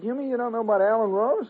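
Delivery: high, disbelieving question; can't believe he hasn't heard; incredulous. Retold or imitated. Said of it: "He said".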